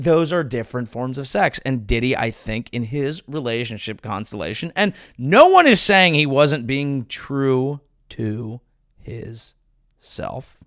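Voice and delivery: ordinary delivery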